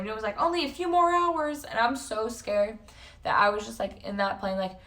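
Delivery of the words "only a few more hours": much higher, slow mock yell; retold scream. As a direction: call it out high and drawn out. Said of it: "someone screamed".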